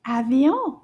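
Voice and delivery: slightly sped-up "Avion". Shown as a demonstration. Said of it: "A little bit faster".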